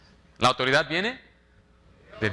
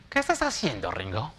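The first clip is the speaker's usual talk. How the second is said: mock nagging boss; 4 semitones lower, 4 dB quieter than usual. Pastor imitates his supervisor questioning him, mock nagging. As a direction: mimic a suspicious boss lady.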